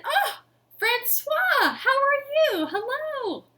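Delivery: overjoyed lion greets his keeper Francois; delighted greeting. Acted. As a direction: gush over a dear friend.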